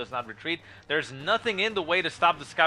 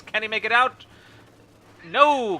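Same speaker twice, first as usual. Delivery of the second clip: rising hype shout; excited shout; 10 semitones higher, 7 dB louder, slower than usual. Real, not acted. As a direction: yell it as the unit dies. Live caster, unit about to die.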